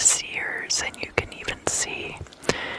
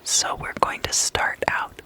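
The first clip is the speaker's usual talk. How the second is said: ordinary talk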